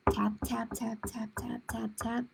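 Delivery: soft whispered tap tap; spooky whispered sound effect; whispered tapping. A narrator's reading. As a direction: breathe each tap like something creeping close. Creeping sounds in story.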